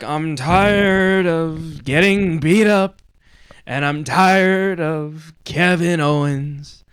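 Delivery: very slow, drawn-out southern preacher drawl; Cody's southern drawl. Retold or imitated. Host mimics Cody's groggy promo in southern drawl. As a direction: stretch every word like a southern pastor. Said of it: "Why does Cody talk like that?".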